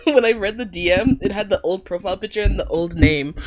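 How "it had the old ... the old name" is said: normal talking voice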